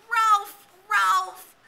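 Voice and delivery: high, loud, anguished call of a name; pained cry. A narrator's reading. Simon calling Ralph in pain, high anguished cry. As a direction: cry out as if hurt. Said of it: "cried out as though he had hurt himself".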